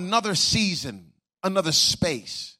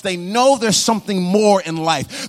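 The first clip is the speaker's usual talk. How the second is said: rising, louder preaching over music; full-force preaching; 4 semitones higher, 4 dB louder than usual. Acted. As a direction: preach it home with mounting intensity.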